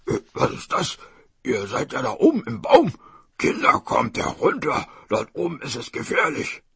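lightly voiced surprised tiger; tiger's exclaimed warning; tiger feigning concern. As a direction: call the children down as a wily tiger.

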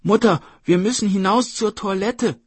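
put-on high, loud child's call; brother calling out. Brother tricks the tiger to get outside, loud urgent call. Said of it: "rief der Bruder".

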